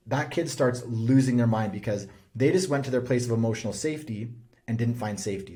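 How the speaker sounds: ordinary delivery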